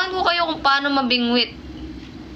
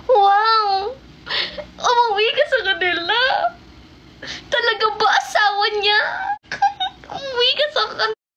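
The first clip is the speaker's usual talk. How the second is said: excited teasing; 7 semitones higher than usual. Real, not acted. Teasing Mama D about going home, high and laughing. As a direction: squeal with delight at the gossip.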